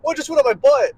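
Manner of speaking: high, loud startled cry; panicked. Pretend. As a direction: yell it like you've been grabbed. Imagined victim's panicked reaction.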